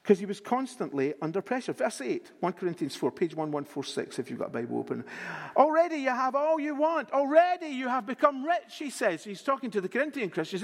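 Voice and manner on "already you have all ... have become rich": louder and higher, mocking praise; sarcastic apostle, raised voice; sarcastic reading. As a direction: read it as biting sarcasm. Reading Paul's sarcastic letter aloud.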